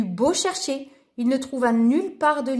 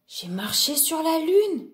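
higher, slow wonder; delighted realisation; 5 semitones higher than usual. A narrator's reading. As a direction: savour it with mild delight. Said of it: "comprit Lou ravi".

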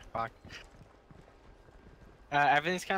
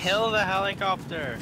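high, loud, drawn-out order; shouting; 5 semitones higher, 6 dB louder, slower than usual. Real, not acted. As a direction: bellow the command. Urging team to shoot.

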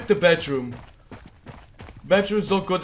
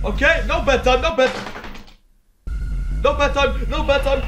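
panicked shout; 8 semitones higher than usual. Real, not acted.

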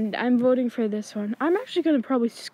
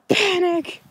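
mock panic; 7 semitones higher, 5 dB louder than usual. Real, not acted. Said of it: "And I am panicking".

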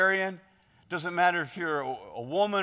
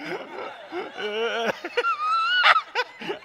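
big laugh. Real, not acted. Preacher bursting out laughing at the interpreter's delivery. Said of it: "got me laughing".

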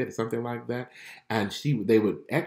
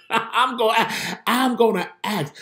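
laughing, much higher and louder; 11 semitones higher, 5 dB louder than usual. Real, not acted. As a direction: laugh through the promise, giddy. Laughing while repeating her resolve.

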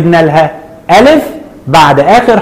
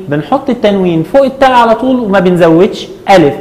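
ordinary talk

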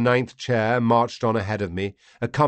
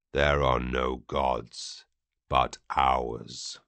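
much lower, slow and heavy; alien lord's voice; 9 semitones lower, 6 dB quieter, much slower than usual. Acted. Vati proclaiming his gods to the captive, low and slow. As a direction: lay down the law like scripture.

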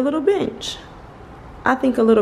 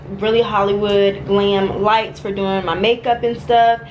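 ordinary delivery

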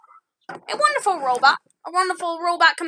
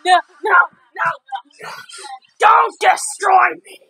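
screaming for mercy in panic; terrified pleading; 7 dB louder than usual. Acted. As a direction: beg loudly, frantic and desperate. Staged robot attack for video.

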